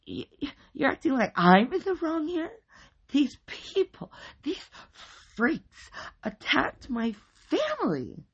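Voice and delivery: very high, loud, incredulous protest; stammer, then stretch words in outrage; outraged sputter. A narrator's reading. Wife protests being blamed by police, outraged.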